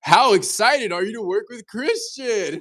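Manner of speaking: friend's prank voice note revealing the joke, hammed-up cheer; mock excited. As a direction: sing it out like a delighted prankster. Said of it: "And then he goes".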